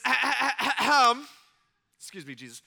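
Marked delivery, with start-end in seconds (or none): 0.0-1.3 exaggerated, loud ahem; loud mock throat clear. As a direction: clear the throat pointedly for attention. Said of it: "He says".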